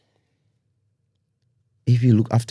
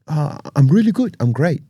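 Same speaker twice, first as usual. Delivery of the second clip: bright, pitched-up cheer; forced cheerful; 8 semitones higher than usual. Pretend. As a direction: fake it till you feel good. Acting upbeat when asked.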